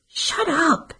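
hissed. A narrator's reading. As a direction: spit it at her. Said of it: "Cece hissed at her".

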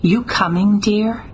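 mild call with a lifted pitch. A narrator's reading. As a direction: toss the question back over your shoulder. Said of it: "She called out behind her".

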